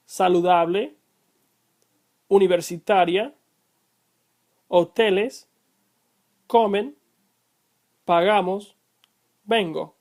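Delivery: Spanish example words read singly, stress on the second-to-last syllable of saludable through vengo. Shown as a demonstration. Teacher reads examples for learners, saludable to vengo with penultimate stress. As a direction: model each word from saludable to vengo, pausing between, penultimate syllable stressed. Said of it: "They are pronounced".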